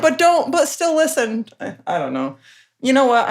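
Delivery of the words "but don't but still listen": high pleading mock order. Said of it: "But I'm still like".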